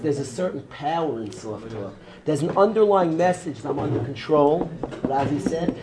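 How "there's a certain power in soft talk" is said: low, very soft voice; soft talk. Shown as a demonstration. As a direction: let the voice sink softly and lower. Softens voice while describing soft talk.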